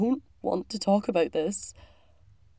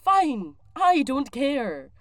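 higher, louder, petulant retort; angry dismissal; 6 semitones higher, 4 dB louder, much slower than usual. Acted. Crewmate ends talk of a sore subject, angry dismissal. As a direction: cut the argument off, stung.

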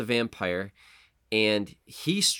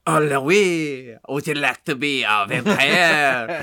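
hammed-up French vampire accent, louder; a lightly put-on French accent, drawn out slow; mock French accent; 4 semitones higher, 6 dB louder, slower than usual. Podcast host mocking Tom Cruise with a French accent. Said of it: "he wasn't like".

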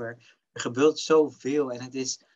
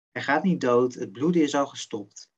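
ordinary talk

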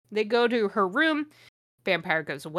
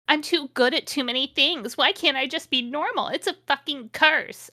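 higher, louder hammed-up shout; mock shout; 6 semitones higher, 4 dB louder than usual. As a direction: yell it like a melodramatic teen. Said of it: "I shouted".

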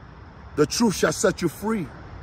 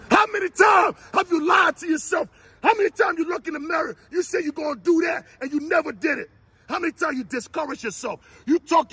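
higher-pitched preacher's call; fiery preaching; 9 semitones higher than usual. Acted. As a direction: preach it out to the crowd. Preaches at full force.

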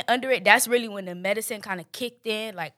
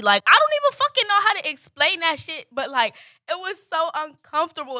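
agitated; 7 semitones higher, 5 dB louder than usual. Real, not acted. Describing a weird painful sensation.

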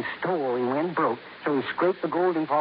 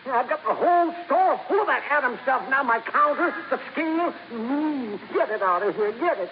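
high, fast, panicky rush; frantic, wants it gone; panicked; 8 semitones higher than usual. Acted. Parker panics about contamination.

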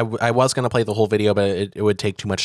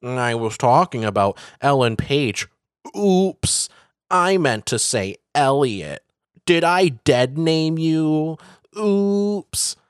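light mock sing-song, rising; mocking fake innocence; 3 semitones higher, slower than usual. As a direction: tease it with faux innocence. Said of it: "him being like".

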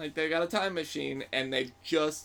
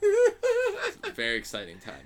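laughing. Real, not acted. Sarcastic aside, laughing.

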